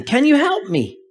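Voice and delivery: high pleading call upward; yelled plea. Acted. Skier clinging to branch calls upward, high pleading yell. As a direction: beg for help from the branch. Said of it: "the skier yells again".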